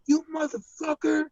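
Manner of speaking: very high-pitched mock fury; imitated angry outburst. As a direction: mimic her blowing up in your face. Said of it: "she just starts blowing up in my face".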